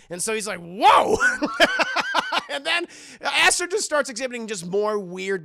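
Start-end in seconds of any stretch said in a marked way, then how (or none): 0.6-1.2 loud exclamation; comically loud "Whoa"; mock shock. Boy reacts to the threat. 1.2-2.4 high, loud cackle; high-pitched peal of laughter; real laugh. Real, not acted. Storyteller cracks up at retelling.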